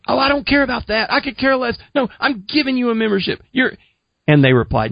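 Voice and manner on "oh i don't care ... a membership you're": high-pitched, quick, eager retort; giddy, dismissive reply. Retold or imitated. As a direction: act out your giddy younger self, pitched up. Acting out own giddy reply.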